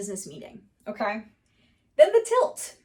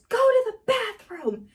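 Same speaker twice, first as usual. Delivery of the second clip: much higher, slowed boss line; mock weary boss; 12 semitones higher, slower than usual. Boss finally sends Timmy away, high slowed mock order. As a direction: deliver the boss's final tired order. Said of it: "the boss says".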